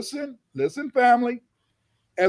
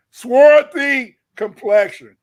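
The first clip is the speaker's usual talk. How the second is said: swarthy slow and loud, falling; stress on swarthy; 9 dB louder, much slower than usual. Shown as a demonstration. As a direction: drag out swarthy loudly. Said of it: "I don't have to make up ish".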